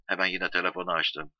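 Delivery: usual speaking voice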